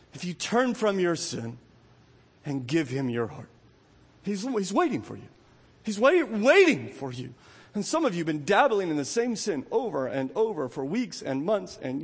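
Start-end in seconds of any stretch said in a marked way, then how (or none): none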